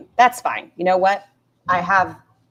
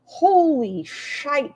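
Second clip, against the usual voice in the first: drawn-out amazement; 9 semitones higher, much slower than usual. Real, not acted. Reacting to story.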